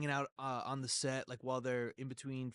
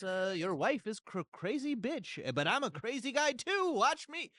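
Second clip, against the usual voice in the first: put-on old-timey voice; 7 semitones higher than usual. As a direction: overact like an old cowboy film. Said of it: "why are you talking in the old-timey voice".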